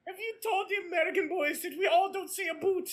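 a lightly voiced Canadian mom teasing about "a boot"; Canadian mom scolding Americans, mock Canadian accent. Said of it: "I can only say a boot so many times".

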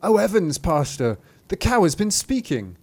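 high, loud, flustered cry; alarmed maid. Acted. Maid runs to the pastor, crying out in alarm. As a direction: act a maid bursting out with shocking news.

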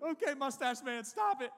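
high, loud squeaky begging; panicked plea. Retold or imitated. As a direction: beg him to get off you. Pinned under the bully.

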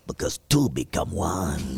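put-on low, slow Spanish-accented line. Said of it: "doing a Spanish accent with it".

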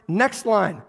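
normal talking voice

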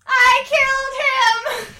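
high, loud wail of guilt; horrified cry. A narrator's reading. Mungo Jerry wails after the child shatters. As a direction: shriek it out in horror.

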